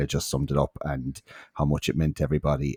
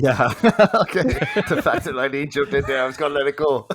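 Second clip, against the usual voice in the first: laughing; 10 semitones higher, 6 dB louder than usual. Real, not acted.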